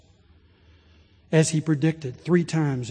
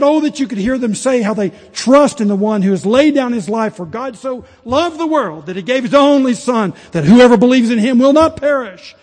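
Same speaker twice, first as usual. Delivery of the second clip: impassioned plea, much louder and higher; pleading bishop; 6 semitones higher, 10 dB louder than usual. Retold or imitated. Bishop Ryle pleading with his congregation, loud and impassioned. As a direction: beg the congregation with full force. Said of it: "He was pleading with this congregation".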